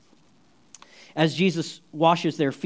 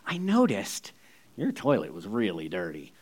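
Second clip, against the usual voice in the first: discreet confiding; 9 semitones lower, 7 dB quieter than usual. Retold or imitated. Queen's delicate remark, acted.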